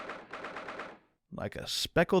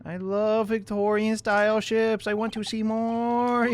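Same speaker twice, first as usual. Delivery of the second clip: mock gushing; 8 semitones higher than usual. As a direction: draw it out high like a smitten fan. Gushing over ships in silly voice.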